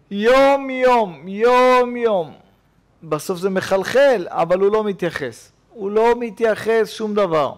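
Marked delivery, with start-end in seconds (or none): 0.1-2.3 slow and steady. Retold or imitated. Noah keeps building, day by day.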